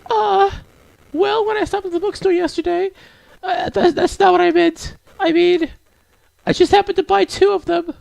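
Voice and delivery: very high falsetto, stumbling excuse; squeaky-high, caught-out character voice; flustered shy girl. Acted. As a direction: backpedal as a bashful girl in falsetto.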